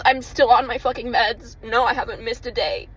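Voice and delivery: ordinary talk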